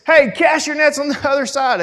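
high-pitched call across the water; yelling. Retold or imitated. Jesus calls to his guys out fishing, yelling. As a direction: call out to men far off. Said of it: "He yells and says".

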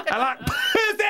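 very high-pitched silly outburst; joke catcall. Acted. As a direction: blurt it high, hamming up the catcall. Joking imitation of catcalling.

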